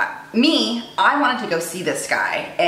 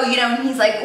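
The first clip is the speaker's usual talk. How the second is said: ordinary delivery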